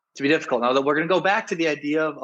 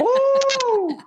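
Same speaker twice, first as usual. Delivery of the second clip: impressed ooh; 4 dB louder than usual. Real, not acted. Reacting to a smooth line.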